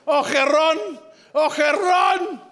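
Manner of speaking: high cries of the name, climbing each time; hammed-up ecstatic moaning call; mock climactic cry. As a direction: build to a high, stretched-out shout. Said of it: "En je roept".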